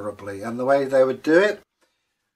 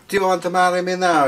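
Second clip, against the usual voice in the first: eager proposal; 6 semitones higher, 4 dB louder, faster than usual. Acted. North Wind proposing, quoted.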